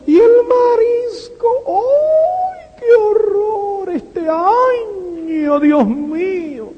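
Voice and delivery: high, drawn-out moaning over prices; mock dramatic lament. A relative at the Christmas table, mock wailing about seafood prices. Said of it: "Me explotan todos los años en esas navidades".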